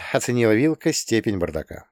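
ordinary delivery, nothing marked

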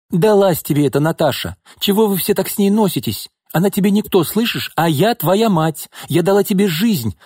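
high, heated outburst; crying out angrily. A narrator's reading. Mother berating her daughter about Natasha, crying out angrily. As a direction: hurl reproaches at the child. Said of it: "выкрикнула Нина".

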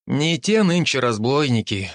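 plain reading voice

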